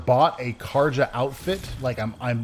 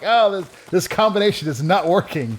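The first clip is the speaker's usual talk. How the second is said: higher, louder mock exasperation; frustrated outburst, pitched up; retold frustration; 6 semitones higher, 5 dB louder than usual. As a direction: act out giving up on the controls. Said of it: "and I'm like".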